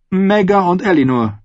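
high, commanding noblewoman; lightly voiced scheming queen. A narrator's reading.

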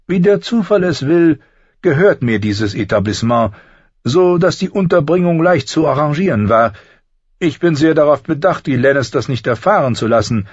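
high-pitched, smug schemer; Littlefinger. Acted. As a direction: lay it out with teasing self-satisfaction. Voices Littlefinger, smug explanation.

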